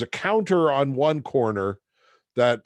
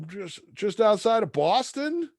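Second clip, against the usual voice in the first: nervous caller; 10 semitones higher than usual. Retold or imitated. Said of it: "And I'm like".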